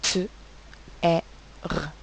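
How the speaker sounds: "T, E, R" as bare sounds: t, è, rrr. Shown as a demonstration. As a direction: repeat "T, E, R" as three separated speech sounds. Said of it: "J'entends donc trois sons".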